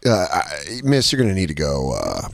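low, fast, weary crew voice; deckhand voice. Retold or imitated. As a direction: hurry the passenger inside, low and fast. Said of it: "the guy working on the boat goes".

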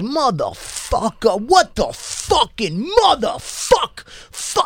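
high, drawn-out mock swearing rant; imitating an enraged man, slow and high-pitched; imitated angry outburst. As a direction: draw out each curse as the ranting stranger.

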